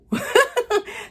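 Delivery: a high, squealing laugh; burst of laughter. Real, not acted. Vlogger cracking up while retelling the movie, high squealing laugh. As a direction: let a giddy laugh break out.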